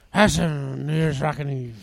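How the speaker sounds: slurred TV host after a stroke, low and soft; Dick Clark. Acted. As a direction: imitate an impaired announcer, muffled and low. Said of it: "more like a Dick Clark where it".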